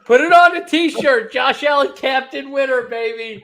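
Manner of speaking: high, loud hype call; hyped shout. Real, not acted. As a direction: shout it like a stadium announcer. Hyping his pick, shouting.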